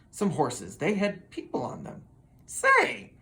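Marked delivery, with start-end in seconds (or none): 2.5-3.1 very high bright exclamation; light up with an idea; excited horse; eager say. Acted. Robert gets an idea.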